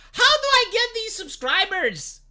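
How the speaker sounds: exasperated. Real, not acted. Exasperated question to chat.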